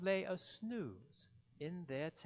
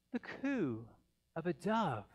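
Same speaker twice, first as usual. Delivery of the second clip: ordinary delivery, nothing marked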